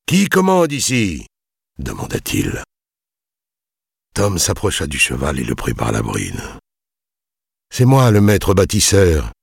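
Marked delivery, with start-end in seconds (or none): 0.1-1.3 high, haughty lordly challenge; imperious demand. A narrator's reading. Young lord challenges the builders. 7.7-9.3 raised, strained answer; tense. A narrator's reading. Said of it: "dit-il d'un ton crispé".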